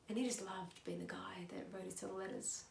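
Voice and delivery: very soft, almost whispered aside; hushed delivery. Shown as a demonstration. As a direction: drop it right down to a murmur. Same monologue, subdued first take.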